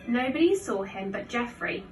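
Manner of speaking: usual speaking voice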